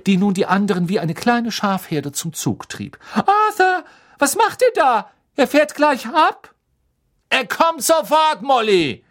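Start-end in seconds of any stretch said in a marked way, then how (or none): 3.2-6.5 high, loud, urgent shout; Misses Weasley calling urgently. A narrator's reading. Misses Weasley warns her husband the train leaves, high urgent yell. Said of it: "rief Misses Weasley". 7.3-9.0 loud, raised shout in reply; calling back. A narrator's reading. Mister Weasley answers his wife across the platform, loud high call.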